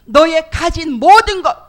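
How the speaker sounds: loud, slow, insistent voice of God; God insisting, loud and slow; booming command. Retold or imitated. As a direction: press the demand down from heaven.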